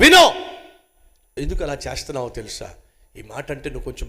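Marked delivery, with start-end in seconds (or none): 0.0-0.3 mock shout. Mocking an angry shouter.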